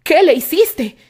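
loud accusing shout; angry shout. A narrator's reading. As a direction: accuse him with a yell. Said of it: "Isaac gritó a Terry".

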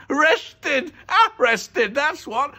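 high, loud, crowing repeats; exultant yelling; gleeful shout. A narrator's reading. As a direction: crow the punchline over and over. Mister Dart repeats his joke.

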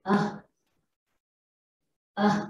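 a short "uh" on its own; brief, separate "uh"; schwa sound. Shown as a demonstration. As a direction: say "uh" as an isolated schwa. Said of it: "This gives the sound of".

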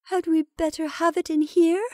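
high, weak, timid question. A narrator's reading. Rebecca doubting the lamp belongs in here, weakly. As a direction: ask feebly in a high, thin little voice. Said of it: "asked Rebecca weakly".

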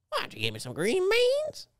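absurdly high-pitched fake South Dakota accent. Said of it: "This is the South Dakota accent".

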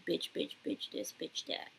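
unvoiced whispered quote; whispering. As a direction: whisper the overheard words. Retelling neighbors' fight.

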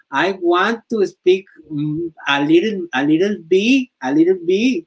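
high, slow broken English; hammed-up English. Showing fans his broken English, hammed up. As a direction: show off clumsy English for laughs. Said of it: "estoy bien pendejo".

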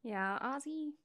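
high, cooing voice for the cat. Real, not acted. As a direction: coo the name to a pet. Talking to her cat.

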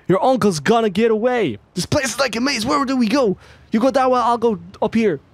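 high-pitched character voice; game character. Acted. Partner warns that the uncle is getting away, high character voice. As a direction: play the chase partner well above your own pitch.

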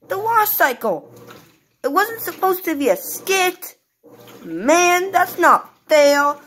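cranky toy bear protesting; baby Teddy voice. Acted. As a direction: complain like a small upset child.

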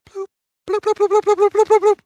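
rapid high-pitched string of bloops; imitated notification bloops. Player imitating pings flooding in mid-game, high rapid bloops. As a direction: mimic a loud flood of message pings.

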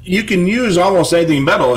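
normal talking voice

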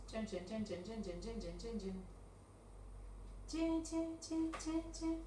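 soft repeated "chin, chin"; quiet, rhythmic filler syllables; chanted filler chinking. Real, not acted. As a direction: chant little chimes to fill the wait. Filler noises while checking her materials.

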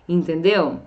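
ordinary talk, nothing marked

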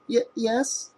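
high, soft, frightened stammer; girl answering the police chief, stammering. A narrator's reading. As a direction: stumble over the yes. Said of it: "she stammered".

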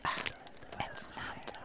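ordinary talk

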